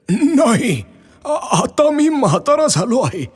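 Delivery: put-on high, thin old man's voice; old Ramu. Aged Ramu asks the tree for a boat, high old voice. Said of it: "रामूने विचारलं".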